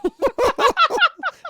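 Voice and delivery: shrill hammed-up cackle breaking in; over-the-top villain laugh; high mock cackle.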